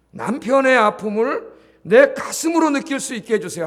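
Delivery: earnest prayer, 내 stressed loud and high; 내 given a high, loud accent; model prayer. Shown as a demonstration. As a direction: say it as a heartfelt plea to God. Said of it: "하나님께 그렇게 기도하라는 거예요".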